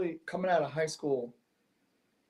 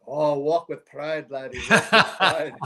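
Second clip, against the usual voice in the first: Scottish guy; 4 dB louder, slower than usual. Retold or imitated. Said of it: "Scottish guy's taking this all in".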